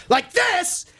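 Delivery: sudden high shout. Shown as a demonstration. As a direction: blast it out so the windows go. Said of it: "one of you shouts".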